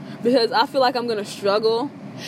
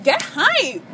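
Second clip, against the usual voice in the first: higher, mock-excited nudge to hype up; hyped-up call; 5 semitones higher, much slower than usual. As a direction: cheer the tired friends on, half teasing. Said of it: "I'm just like".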